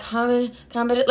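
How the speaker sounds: softly repeated, halting COMPETI; sounding it out. Retold or imitated. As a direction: try COMPETI under your breath, breaking off unfinished. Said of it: "I'm sounding it out like".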